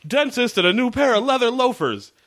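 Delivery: high, loud joke-telling voice; joking dad voice. Retold or imitated. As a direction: deliver the dentist joke like a loud showman. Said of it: "he's like".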